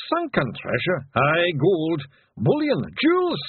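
high, rising Feegle glee over treasure; happy sigh. A narrator's reading. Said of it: "sighed happily".

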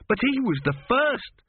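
high, protesting lad's voice; young apprentice. A narrator's reading. Apprentice protests the mummy's order, high-pitched. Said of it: "said Gurn".

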